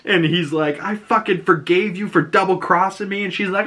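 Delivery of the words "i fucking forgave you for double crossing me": high-pitched mock indignation; retold angry outburst. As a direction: act out an angry accusation, pitched up. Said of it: "they kind of blew up at each other".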